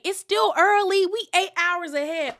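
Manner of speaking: high, loud, slowed imitation; loud retold quip. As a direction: pitch it way up and call it out, mock-reassuring. Retold quip by Heather, laughing after.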